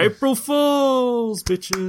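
high, long-drawn triumphant call; gleeful mock reveal. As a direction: stretch it out high like a prankster's big reveal. Acting out the villain's final reveal.

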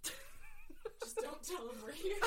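laughing, pleading through giggles. Real, not acted. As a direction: beg while cracking up. Laughing plea.

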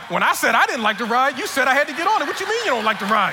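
high, loud mock outrage of a kid; indignant son. Son calls out dad over the ride, indignant protest. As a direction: imitate a child loudly protesting unfairness.

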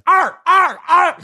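high screeching raptor call; raptor noise. Pretend. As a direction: shriek like a raptor. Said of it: "That was a raptor noise".

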